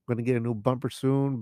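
usual speaking voice

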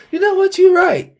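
very high-pitched mock concession; imagined woman conceding. Imagined friend returning to admit it, conceding. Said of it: "came back to you like".

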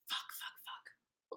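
slow hushed swearing, barely voiced; panicked whisper. Real, not acted. Her cat just woke mid-recording, whispered alarm. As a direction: whisper it urgently to yourself.

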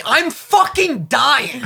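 high, drawn-out mock agony; desperate retold plea. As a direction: stretch it out as exaggerated suffering. Retelling agony to the slow nurse.

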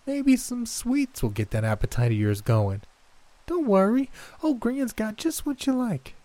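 put-on high sugary coaxing old woman; coaxing granny voice. A narrator's reading. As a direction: tempt like a creepy grandma.

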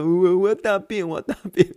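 hammed-up pleading boy; kid's plea. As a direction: stretch it like a desperate kid. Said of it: "你就说".

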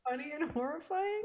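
high-pitched, on the edge of laughter; real amusement pushing the voice up; tickled and horrified. Real, not acted. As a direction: talk while trying to recover from laughing. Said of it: "I find that so funny".

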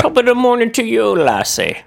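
mock Irish accent. Husband joining the joke, high mock Irish. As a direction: answer like a squeaky Irish leprechaun. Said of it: "answering the leprechaun bit".

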